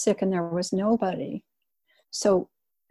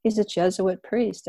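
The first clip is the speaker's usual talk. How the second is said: ordinary delivery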